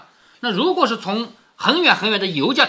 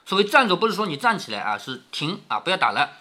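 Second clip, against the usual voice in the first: plain reading voice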